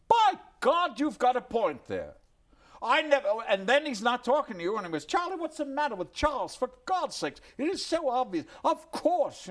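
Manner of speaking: very high, loud, excited exclamations; Chaplin struck by an idea. Retold or imitated. Chaplin talking to himself about his Hamlet, very high and excited. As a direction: burst out like Chaplin seeing it at last.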